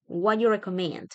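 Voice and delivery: "What you" blended into "wha-you"; reduced question, "What" gliding into "you"; dropped "do". Shown as a demonstration. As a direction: leave "do" out entirely. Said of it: "solamente va a sonar what you".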